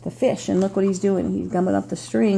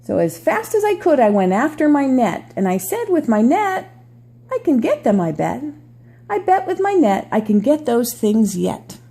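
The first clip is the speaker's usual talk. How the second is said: storybook read-aloud voice; reading to children; 3 dB louder than usual. Acted. Reads picture book aloud.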